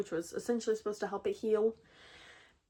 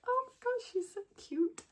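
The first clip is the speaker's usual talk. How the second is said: high, squealy gush over a cute bunny; mock high-pitched aww; gushing squeal; 13 semitones higher, 4 dB quieter than usual. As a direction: squeal it like you've just seen the cutest photo. Gushing over the rabbit photo.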